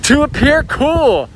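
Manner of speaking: high, slow, booming repeat; mock game-show host. Host repeating wife's survey answer, game-show style. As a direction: announce it high and drawn out.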